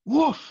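excited dog wanting ice cream; excited bark. Acted.

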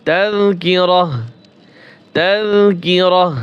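তাযকিরাহ drawn out slowly, ending in "rah"; ta read as ha. Shown as a demonstration. Teacher showing ta becomes ha when pausing, slow h ending. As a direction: slowly stop on তাযকিরাহ with a final h. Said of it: "এই তা হা উচ্চারণ হবে".

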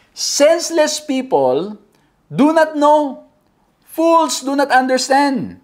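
slow, emphatic scripture reading, pitched high. Acted. Preacher reads a psalm's warning to the congregation, slow declamation. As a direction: read it out slowly, phrase by phrase.

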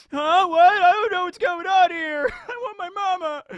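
very high, loud blubbering wail; hammed-up babyish crying; mock crying. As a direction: whimper in confused panic. Weevil bewildered and crying.

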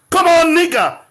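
loud, high-pitched taunting call; heated call-out. Real, not acted. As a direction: call it out loudly at your opponent. Calls out in heated debate.

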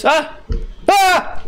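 sudden high yelp of fright; startled scream. Real, not acted. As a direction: scream as the jumpscare hits. Jumpscare in horror game. (teriakan kaget ketakutan)

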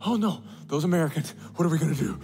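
fast, fretful mock worrying; playful fake fretting; mock panic. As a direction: ham up a nail-biting God. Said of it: "He has never been nervous".

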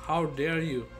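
ordinary talk

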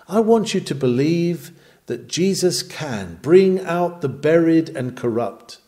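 ordinary talk

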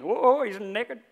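high, drawn-out mock Indian accent. Little boy exposes the king, comic Indian accent. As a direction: sing it out loudly in an Indian accent. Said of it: "Or Indian".

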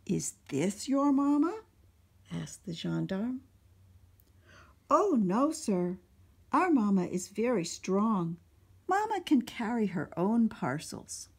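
put-on animated children's story narration; storytime read-aloud. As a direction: read it out to a room of little kids. Reads picture book to children.

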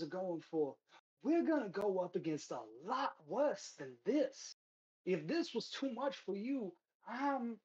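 hesitating recruiter picking his words carefully; hesitant. Acted. Recruiter hesitating as he asks the party for help, lightly voiced. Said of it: "you see him hesitate".

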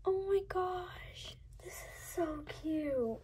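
slow, soft unvoiced whisper, full of awe; slow breathless whisper of nostalgia; awed whisper. Real, not acted. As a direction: hush it like you might cry. Overwhelmed by a nostalgic toy.

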